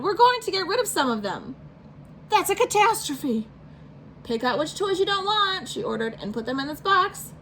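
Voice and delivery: a mother laying down the law; stern mom voice. Acted.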